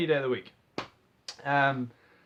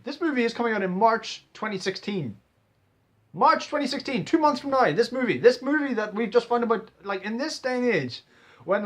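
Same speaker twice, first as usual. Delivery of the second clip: excited; 4 semitones higher, 4 dB louder than usual. Real, not acted. Excited about sudden release.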